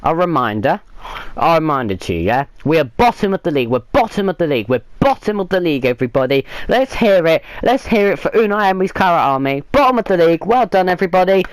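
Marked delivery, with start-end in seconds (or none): 2.6-11.4 sarcastic celebration. Real, not acted. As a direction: cheer the disaster like a party, dripping sarcasm. Sarcastic mock celebration of losing.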